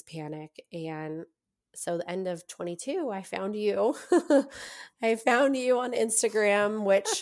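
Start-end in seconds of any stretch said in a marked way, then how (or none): 4.0-5.0 a high, embarrassed little laugh; giggle. Real, not acted. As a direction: laugh it off, a bit sheepish. Laughing about finding the host.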